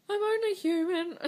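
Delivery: high voice breaking through tears; tearful, strained, voice cracking high and loud; crying. Real, not acted. Woman telling her viewers about IVF, crying in a high, breaking voice. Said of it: "I think I'm crying".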